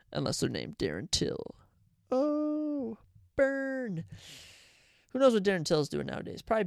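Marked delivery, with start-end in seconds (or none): 2.1-4.0 teasing. Real, not acted. Teasing jab at Darren Till.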